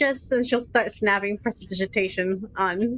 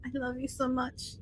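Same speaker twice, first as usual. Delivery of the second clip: tender cooing; 8 dB quieter than usual. Acted. Cuddling her pet.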